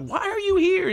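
very high, hammed-up squeal; mock freak-out. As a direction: squeak it as if appalled by an intruder. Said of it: "like, freaks out".

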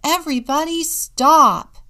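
high, loud command to the whole room; shouted order, punched on both words; full shout. A narrator's reading. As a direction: yell for everyone to halt. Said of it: "shouted Julia".

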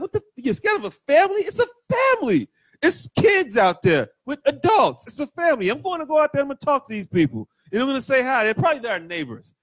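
high, incredulous dad voice; bewildered dad. Retold or imitated. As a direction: play the baffled suburban father. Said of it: "He was like".

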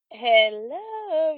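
high, loud, drawn-out creepy greeting; extra creepy. Retold or imitated. Mimicking the spirit laughing at them, playfully creepy. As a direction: imitate a ghost stretching it out to spook people. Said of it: "to be extra creepy sounding".

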